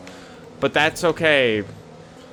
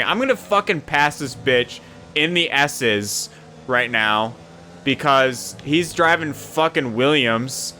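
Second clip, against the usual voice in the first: ordinary talk, nothing marked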